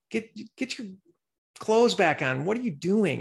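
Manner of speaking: high mock scolding; imitating shocked classmates; scolding students. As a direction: tell her off, half laughing.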